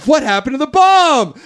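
high, loud, dramatic outcry; mock alarm. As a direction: imitate a panicked group realization. Spice Girls' alarmed question before explosion.

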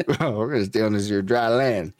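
a hint of a backwoods old-timer. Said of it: "why I'm talking like an old redneck".

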